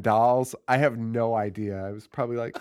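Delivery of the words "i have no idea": ordinary talk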